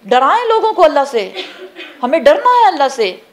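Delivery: fervent preaching in a high, pressing voice; urgent high-pitched admonition; impassioned exhortation. Real, not acted. Preacher pressing the warning home.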